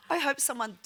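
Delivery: real tears coming into the words; getting emotional. Real, not acted. Speaker recalling a stranger's kindness, choking up. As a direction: voice rising and starting to choke up. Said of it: "oh, I'm gonna get emotional".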